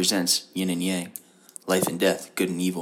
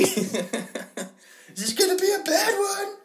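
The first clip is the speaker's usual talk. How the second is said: very high, slow worried question; worried sponsor; much slower than usual. Pretend. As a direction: ask it in a drawn-out squeak.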